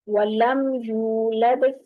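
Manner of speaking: वलम यूलद slowly, with a long rounded oo; rounded lips. Shown as a demonstration. Teacher modelling the verse, slow rounded vowel. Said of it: "गोल करेंगे बाकायदा".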